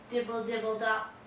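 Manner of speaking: usual speaking voice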